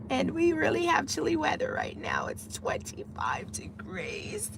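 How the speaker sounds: high, strained fake-tearful complaint; mock wail. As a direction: play up the misery until the voice squeaks and breaks. Mock complaint about Florida cold.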